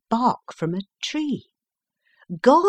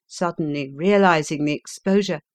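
usual speaking voice

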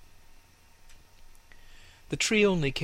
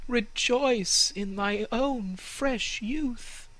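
high, slow, gentle airy voice; slow gentle sunlight voice; air and sunlight; 13 semitones higher, much slower than usual. Acted. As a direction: soothe the tree slowly.

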